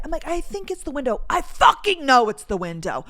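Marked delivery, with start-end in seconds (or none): 0.3-1.2 high, tentative polite hedge; put-on courteous uncertainty; trying to be polite. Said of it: "me trying to be polite". 1.3-3.0 louder, emphatic irritation; indignant, forceful insistence; exasperated certainty. Retold or imitated. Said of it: "I was trying to be nice".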